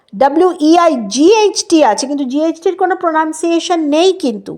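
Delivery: ordinary delivery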